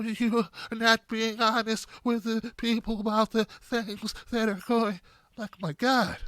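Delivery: soft, slow, lightly tearful politician impression; a hint of holding back tears, slow and soft; mock teary Klobuchar. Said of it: "like she's about to burst into tears the whole time".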